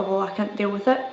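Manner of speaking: ordinary talk, nothing marked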